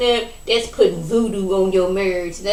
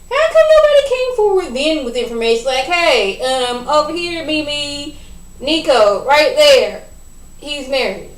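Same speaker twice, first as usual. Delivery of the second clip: exasperated calling out; 5 semitones higher, 3 dB louder than usual. Real, not acted.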